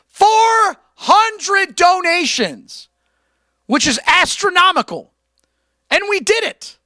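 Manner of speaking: loud, high, stretched-out hype; excited shout. Real, not acted. Streamer bragging about hitting the donation goal, shouting excitedly. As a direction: shout it like a huge win.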